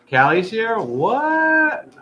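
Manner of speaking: high, loud surprised shout; excited surprise. Real, not acted. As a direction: shout it out in happy shock.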